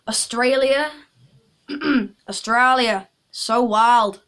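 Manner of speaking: loud hammed-up Australian accent; bad Australian accent. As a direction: put on a cheesy Australian drawl. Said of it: "sorry for that bad accent".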